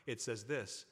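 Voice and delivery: normal talking voice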